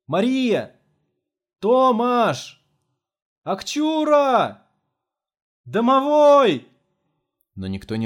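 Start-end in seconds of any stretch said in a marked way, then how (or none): 0.0-6.7 высокий протяжный крик вдаль; зови их во весь голос, растягивая; зовущий крик. A narrator's reading. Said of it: "кричал". (громкий протяжный зов)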